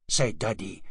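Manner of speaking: ordinary delivery, nothing marked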